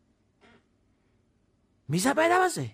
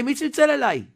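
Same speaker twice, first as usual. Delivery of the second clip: מי צלצל אליי shouted high and loud, stressing צלצל; indignant shout; 7 semitones higher, 5 dB louder than usual. Retold or imitated. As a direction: demand it loudly, outraged. Manager shouting at the guard.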